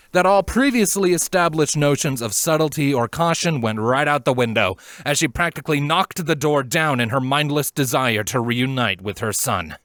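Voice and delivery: ordinary delivery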